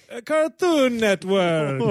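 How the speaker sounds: bad Italian accent. Co-host losing his train of thought, hammed-up bad Italian accent. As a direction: stretch it into a hammy Italian flourish. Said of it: "say a network in an Italian accent".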